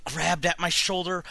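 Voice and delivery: agitated reading with high-pitched cries of "Mom"; frantic horror narration. A narrator's reading. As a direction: tell the attack as it happens, alarmed. Mother attacks narrator with cleaver.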